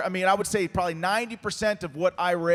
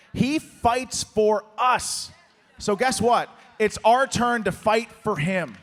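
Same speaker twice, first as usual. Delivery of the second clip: rally shout; 3 semitones higher, 4 dB louder, slower than usual. Real, not acted. Rally call to the crowd.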